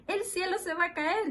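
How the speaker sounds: high cry at ordinary volume; storybook animals calling out an alarm; mock shouting. A narrator's reading. (grito fingido y agudo)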